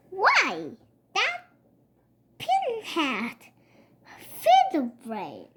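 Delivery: a touch of a snarl, lightly read; mild snarl. A narrator's reading. Papa bumped into a car, mildly snarling. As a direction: grumble the insult with mild anger. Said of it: "he snarled".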